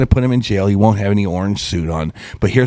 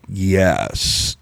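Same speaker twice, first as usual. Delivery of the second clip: deeper voice; 5 semitones lower, 5 dB quieter than usual. Retold or imitated. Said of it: "all of us Americans were like".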